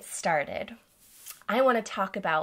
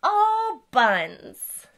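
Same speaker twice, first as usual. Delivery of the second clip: silly phrase; 5 semitones higher, 6 dB louder than usual. Shown as a demonstration. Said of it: "I can say".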